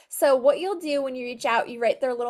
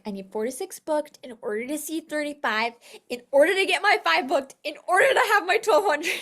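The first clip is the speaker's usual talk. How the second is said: mock overwhelmed rush; 4 semitones higher, 4 dB louder, faster than usual. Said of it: "So you're like".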